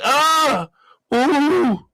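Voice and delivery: high, drawn-out ahs and oohs.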